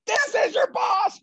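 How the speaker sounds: high, furious shout, words drawn out; acted full-force tirade; boss losing his mind. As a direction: bellow like an irate boss on the phone. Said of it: "And he lost his mind".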